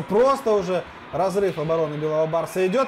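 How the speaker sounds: ordinary delivery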